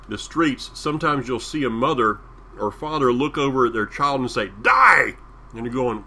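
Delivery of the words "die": loud, high shouted command; sharp parental shout. Retold or imitated. A mother or father to their child in Israel, shouted.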